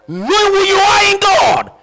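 high, loud preaching cry; preaching shout. Real, not acted. Preacher shouting identity to the congregation, loud and high.

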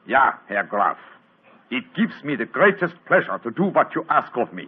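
put-on Holmes putting on a German count's accent, showing it off; a lightly laid-on German accent, with a laugh in it. Holmes shows off his German accent to the count. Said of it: "And my accent, you, uh, find it reasonably convincing?".

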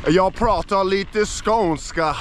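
slow southern Swedish accent; Skånish accent. Shown as a demonstration. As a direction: speak Swedish in a Skåne accent, unhurried. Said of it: "I have the Skånish accent".

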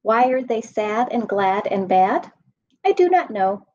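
plain reading voice

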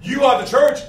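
loud, driving sermon delivery; fiery pulpit proclamation; full-force preaching. Acted.

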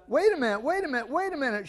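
high, loud wait a minute, sped up; shouted. Acted. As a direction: cry out to cut him off. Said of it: "shouted the twenty dollar bill".

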